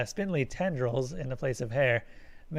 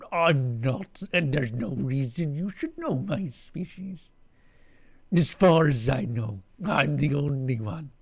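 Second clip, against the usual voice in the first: alien creature voice. Acted. Alien voice again.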